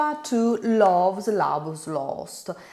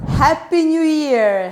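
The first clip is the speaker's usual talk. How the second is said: loud, higher-pitched cheer; festive greeting; 6 semitones higher, 8 dB louder than usual. Real, not acted. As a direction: call out a celebratory wish to your audience. New Year greeting to viewers.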